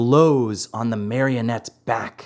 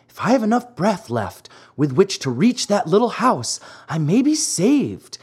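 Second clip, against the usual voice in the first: higher, lightly acted puppet on the run; a touch of breathless hope in a higher pitch; mildly urgent hope; 6 semitones higher than usual. Fleeing marionette spots a little house, mildly urgent hope.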